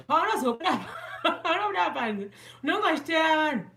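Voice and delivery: high, loud, laughing teasing; teasing giggle about shiny people outside. Real, not acted. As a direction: laugh through the tease.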